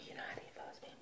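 breathless whispered aside; fast whispered aside. Real, not acted. Aside after sigh.

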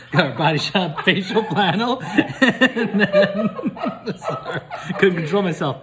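giggling through the words; can't stop laughing. Real, not acted. Laughing at the facial flannel to the camera. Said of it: "I got a little excited".

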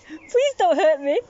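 hammed-up fear of the cat; mock plea. Owner mock-begs the playful cat not to hurt her.

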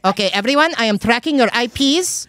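put-on loud, high, threatening Filipino accent rant; angry Filipino accent. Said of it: "very thick, like, Filipino accent".

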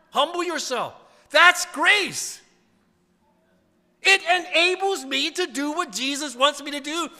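loud, high, fervent preaching; preacher rising to a loud, high pitch; preaching at full force. Acted. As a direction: drive the point home to the congregation.

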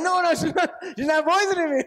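high, loud protest through laughter; laughing denial. Retold or imitated. Retells his laughing reply to friend.

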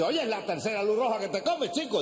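high-pitched startled protest; alarmed passenger. Acted.

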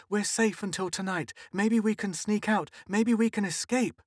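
high, teary comforting; sobbing. A narrator's reading. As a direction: soothe through sobs. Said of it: "sobbing against the door".

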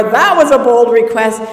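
sarcastic jab. Retold or imitated. Jesus jabbing at Peter's bold request, sarcastic. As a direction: needle him for his boldness.

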